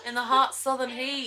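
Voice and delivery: high, whiny Southern sufferer; overheated Southerner. Acted. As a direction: wilt melodramatically in Southern heat. Playing weary Southern gas pumpers.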